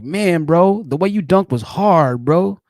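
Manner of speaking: mock bro excitement; hype bro. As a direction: hype up a friend's dunk. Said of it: "you're like".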